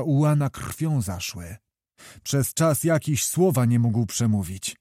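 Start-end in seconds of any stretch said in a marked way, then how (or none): none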